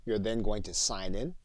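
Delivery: ordinary talk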